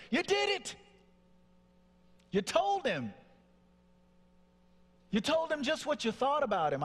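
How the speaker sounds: high-pitched celebrating voice; party cheer. Retold or imitated. Inner voice congratulates son on telling his father, party cheer. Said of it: "it's like a party going on".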